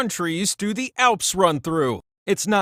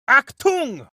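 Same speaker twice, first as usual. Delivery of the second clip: mock German bark; 9 semitones higher than usual. German hint.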